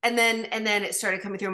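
ordinary delivery

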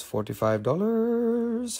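slow, high and drawn out; high sing-song price call. Acted. Repeating price in varied tones.

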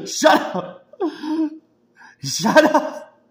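high, loud, breathy "shut up" between laughs; laughing shout at the commentator; mock yell. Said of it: "Sometimes I'm just like".

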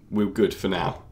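usual speaking voice

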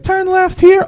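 very high female-GPS impression; high-pitched mock navigator; bossy GPS voice. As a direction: give directions in a squeaky falsetto. GPS giving orders, voiced by comic.

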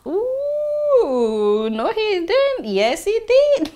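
high, teasing ooh, no he didn't; gossip-style shock, then the answer, slow; sassy disbelief. Real, not acted. The teller reacts to the bird's comeback with sassy disbelief.

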